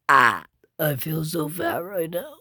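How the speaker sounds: ordinary talk, nothing marked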